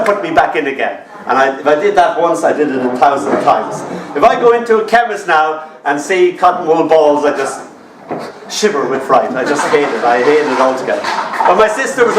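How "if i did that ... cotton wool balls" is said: ordinary talk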